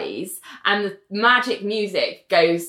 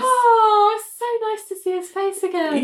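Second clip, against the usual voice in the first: gushing; 13 semitones higher than usual. Real, not acted. Gushing high.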